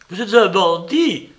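cri fort en se débattant; s'écrier. Acted. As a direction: hurle l'accusation en luttant. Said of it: "s'écria-t-il en faisant des efforts terribles". (s'écrie en se débattant pour se dégager)

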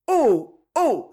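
high, loud gasps of horror; horrified cry. A narrator's reading. Marion hearing the prisoner howl, horrified cries. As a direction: cry out in terror at the screams. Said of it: "ces cris, ces hurlements d'horreur".

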